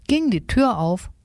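usual speaking voice

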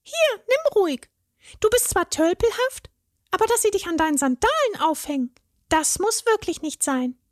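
very high fairy voice, kindly teasing; generous fairy. A narrator's reading. Fairy gives the troll a leg, high and kindly teasing. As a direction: give a gift while ribbing the oaf.

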